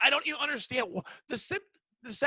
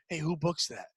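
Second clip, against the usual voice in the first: mock whisper; 5 semitones lower, 6 dB quieter than usual. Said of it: "I'll whisper to you".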